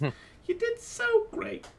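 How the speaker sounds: very high, slow and syrupy; gushing praise. Acted. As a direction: lay the flattery on thick. Half-elf praising artist.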